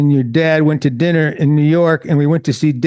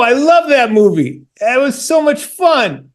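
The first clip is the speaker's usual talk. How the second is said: excited; 7 semitones higher than usual. Real, not acted. Excited about the movie.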